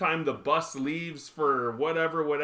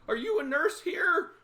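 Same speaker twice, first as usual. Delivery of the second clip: put-on very high old-lady voice; high-pitched elderly questioner; 14 semitones higher than usual. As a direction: imitate an old woman asking in a high pipe. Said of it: "she comes out and she's like".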